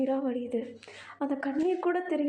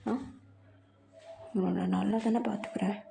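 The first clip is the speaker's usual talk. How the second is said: put-on low, whispered possessive boyfriend; possessive lover; 4 semitones lower than usual. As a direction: lower and hushed, cornering him in the back seat. Jungkook voiced low, possessive. (தாழ்ந்த குரலில் உரிமை கொண்டாடும் காதலன்)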